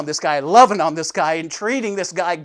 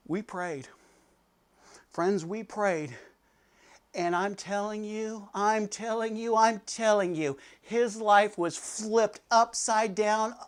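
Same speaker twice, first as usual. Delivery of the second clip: soft, slow and sincere; hushed earnest; 8 dB quieter, slower than usual. Real, not acted.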